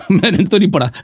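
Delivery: chuckling through the line; laughing. Real, not acted. Debater joking he never studied at a madrasa, chuckling. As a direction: laugh it off at his own expense. Self-deprecating joke with laugh.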